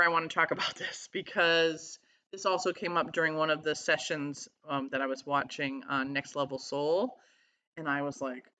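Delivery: ordinary talk, nothing marked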